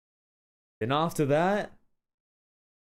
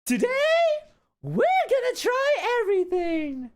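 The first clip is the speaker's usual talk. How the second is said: very high, held squealing voice; high-pitched performance; 5 dB louder than usual. Acted. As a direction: push it far up and hold it there. Breaks into a high performed line.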